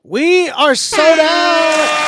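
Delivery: high, loud shout with drawn-out words; hyped announcement. Real, not acted. Streamer announcing a sellout to viewers, hyped shout. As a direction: shout it out long and high, hyping the crowd.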